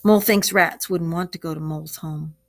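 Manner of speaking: usual speaking voice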